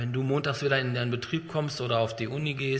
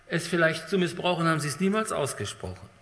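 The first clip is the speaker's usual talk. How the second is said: ordinary talk, nothing marked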